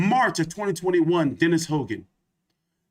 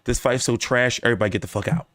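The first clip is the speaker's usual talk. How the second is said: low mocking official voice; 6 semitones lower, much faster than usual. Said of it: "They was like".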